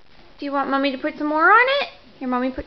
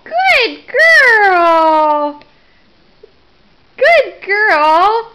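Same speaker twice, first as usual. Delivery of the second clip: loud cheering praise; 4 semitones higher, 8 dB louder, much slower than usual. Real, not acted. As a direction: cheer her on at full volume. Cheering baby feeding herself.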